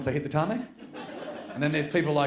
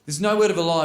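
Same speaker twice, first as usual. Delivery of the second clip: ordinary talk, nothing marked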